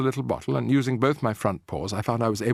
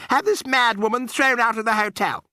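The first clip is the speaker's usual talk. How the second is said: haughty lady; 12 semitones higher, 5 dB louder than usual. A narrator's reading. Mrs Jenkins demanding.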